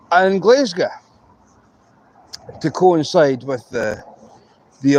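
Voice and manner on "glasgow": GLASGOW said "Glez-ga"; local pronunciation. Shown as a demonstration. Scot teaching outsiders, Glasgow as local "Glez-ga". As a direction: say Glasgow with "ez" and a short "ga". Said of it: "we say Edinburgh like normal people".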